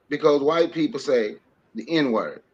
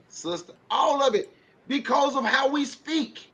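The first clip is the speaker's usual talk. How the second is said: normal talking voice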